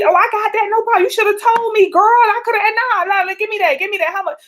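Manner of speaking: high, loud eager man's voice; a man keen to treat her, voiced up high; generous man. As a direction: play the smooth guy offering to pay. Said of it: "So, and he".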